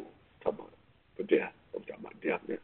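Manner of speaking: hushed aside. Acted. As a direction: drop to a whisper. Sudden hushed aside in sermon.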